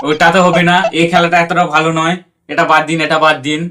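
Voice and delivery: ordinary talk, nothing marked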